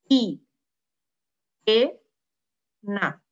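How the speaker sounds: hiena said as i, e, na with pauses; split into syllables. Shown as a demonstration. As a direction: spell out hiena slowly as i, e, na. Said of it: "Como é que a gente separa sílabas".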